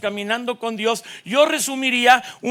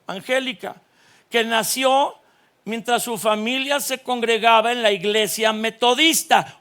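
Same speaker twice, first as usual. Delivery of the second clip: normal talking voice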